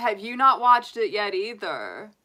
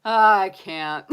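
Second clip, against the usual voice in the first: ordinary talk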